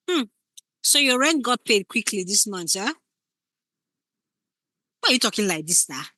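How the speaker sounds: suspicious partner, insinuating; pointed insinuation. Shown as a demonstration. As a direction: needle them without accusing. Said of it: "You can see the difference".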